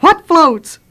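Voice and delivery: high, loud terrified shout; scared yell. Acted. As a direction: yell it out, scared but needing to know. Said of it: "so he yelled out".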